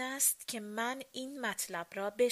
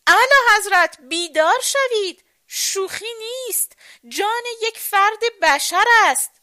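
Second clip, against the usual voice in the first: loud, high, urgent shout; 11 semitones higher, 12 dB louder than usual. A narrator's reading. Crown prince rousing the sleeping king, urgent shout. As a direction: yell to wake someone hard of hearing. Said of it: "زیر گوشش فریاد کشید".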